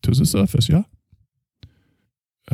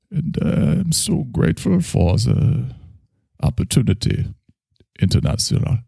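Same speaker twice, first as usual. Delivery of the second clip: put-on German accent; 6 semitones higher than usual. German therapist character.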